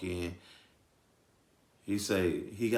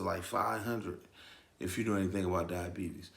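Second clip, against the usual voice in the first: ordinary talk, nothing marked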